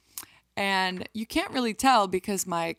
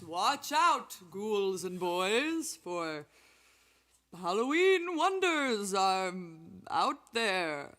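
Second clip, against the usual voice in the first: spooky voice; much slower than usual. Acted. Spooky Halloween announcement.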